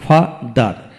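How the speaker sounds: Father in two separate beats; syllable by syllable. Shown as a demonstration. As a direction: break father with a short gap. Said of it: "যে ওয়ার্ডে দুটি syllable থাকে".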